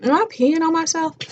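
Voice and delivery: high, startled self-question; alarmed disbelief. Retold or imitated. Narrator retelling her water breaking, voice jumps high in alarm. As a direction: sound suddenly unsure of your own body. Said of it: "I was like".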